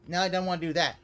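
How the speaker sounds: ordinary delivery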